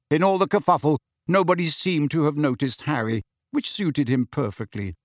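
ordinary delivery, nothing marked

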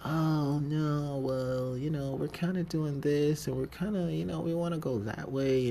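high, mocking brush-off voice; dismissive bosses. Bosses brushing off his ideas.

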